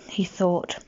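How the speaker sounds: ordinary delivery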